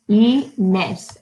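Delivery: "Inés" split into I and nés; syllable by syllable. Shown as a demonstration. Teacher counting syllables for children, "Inés" broken in two. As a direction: say "Inés" in two separate beats. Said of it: "count the syllables".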